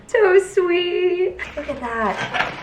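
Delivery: high-pitched, drawn-out aww; melting, high coo of gratitude; touched gushing. Real, not acted. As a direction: gush at a sweet note. Gushing over mom's gift note.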